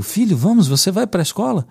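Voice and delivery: a hint of a patient father; urging dad. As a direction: nudge the boy out of the car. Father urging hesitant son.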